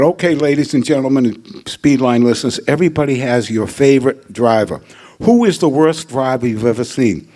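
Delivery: normal talking voice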